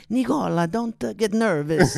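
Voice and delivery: imitated Italian director; slow down into an Italian accent to settle an actor. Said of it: "the director was Italian".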